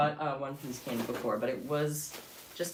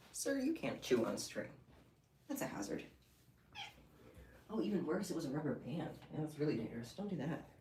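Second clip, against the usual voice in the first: gentle scolding; 7 dB quieter than usual. Real, not acted. Owner softly scolding her pet for chewing string.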